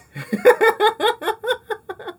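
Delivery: loud incredulous cackle; high disbelieving laugh. Real, not acted.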